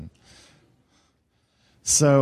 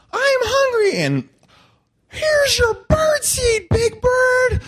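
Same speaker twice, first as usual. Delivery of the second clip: squeaky high voice; much slower than usual. Retold or imitated. Big Bird and Chris exchange.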